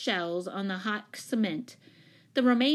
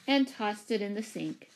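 ordinary delivery

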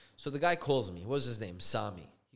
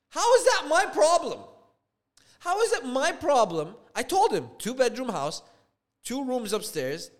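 loud, high-pitched outraged retort; angry shouting; 9 semitones higher, 7 dB louder than usual. Retold or imitated. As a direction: yell back in indignation. Re-enacts angry quarrel over price.

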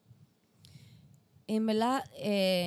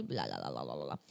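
mock blah blah; 6 dB quieter than usual. Mocking her own rambling.

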